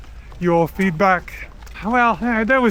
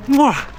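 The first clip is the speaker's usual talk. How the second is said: blown kiss; 6 semitones higher than usual. Real, not acted. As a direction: blow a kiss to the crowd. Blowing kisses to the city.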